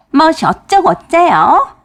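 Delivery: high, loud and slow indignant cry; outraged retort. Retold or imitated. Wife outraged at her husband's remark, loud and high. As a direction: draw it out in shrill-free outrage, up and loud.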